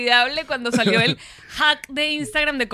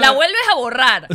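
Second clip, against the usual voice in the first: tutorial voice; 3 semitones higher, 8 dB louder than usual. Retold or imitated. Mimicking the TikTok tutorial.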